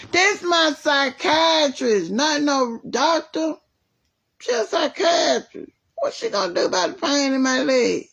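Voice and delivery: exasperated, almost crying out; indignant outburst. Real, not acted. To the caregiver, insisting a psychiatrist can't fix her leg: high exasperated outcry.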